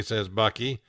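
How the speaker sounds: plain reading voice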